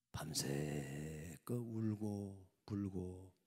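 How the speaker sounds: slow, soft unvoiced whisper. A narrator's reading. Preacher telling of the night of weeping: slow whisper. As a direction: linger on it in a whisper.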